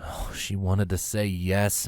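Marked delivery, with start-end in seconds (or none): none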